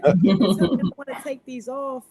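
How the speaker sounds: mock German accent; German grandmother accent. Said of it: "the accent's heavy".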